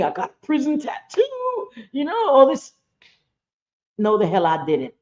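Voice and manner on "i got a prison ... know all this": high mock boast, lightly voiced with a fake whimper; hammed-up imitation of someone faking hard prison time; mocking a braggart. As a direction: mimic a liar bragging about prison, mock-teary. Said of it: "Imagine me".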